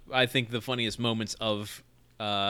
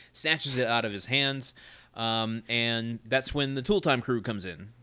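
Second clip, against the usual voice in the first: ordinary delivery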